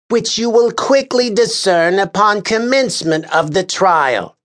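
high, officious, exasperated voice; pompous official scolding a juror; exasperated Lars Kern. A narrator's reading. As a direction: lecture the juror with weary disdain. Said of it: "he finished".

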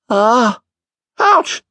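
high hammed-up ah and ouch; theatrical little pain noises; mock pain cries. A narrator's reading. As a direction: fake wincing yelps for show. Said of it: "uttering all his usual expressions of pain".

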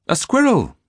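put-on high, loud child's cry of delight. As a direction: call out with a child's joy. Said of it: "the children cried in delight".

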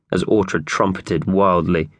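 usual speaking voice

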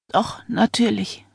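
a light croak, slow and strained. A narrator's reading. Frightened wife to her husband, a light slow croak. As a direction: croak it out, barely daring to breathe. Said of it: "krächzte sie".

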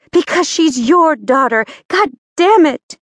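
high, loud furious yell; angry outburst. Acted. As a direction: scream it out in fury. Maria blurts out the secret.

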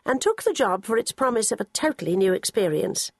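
ordinary talk

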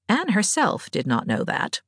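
ordinary delivery

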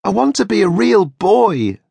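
put-on a wooden boy pleading to be real; Pinocchio. A narrator's reading. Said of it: "Pinocchio said".

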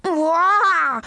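loud, high, long scream. A narrator's reading. Patient screaming so the waiting room hears, loud. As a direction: scream out in desperation. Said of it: "schrie er".

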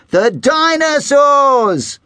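high, slow, drawn-out announcement; dramatic reveal. A narrator's reading. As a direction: unveil it like a grand surprise. Narrator's big reveal.

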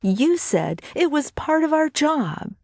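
high-pitched, upset accusation; indignant retort. Acted. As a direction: throw it back at him, pitched high. Brittany throws it back, glaring.

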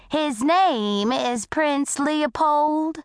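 put-on high, slow, drawn-out old lady voice; unperturbed old lady. A narrator's reading. Old lady corrects the bully, unperturbed old lady voice. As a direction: stretch it out grandly, untroubled by the rudeness.

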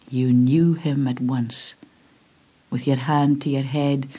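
plain reading voice

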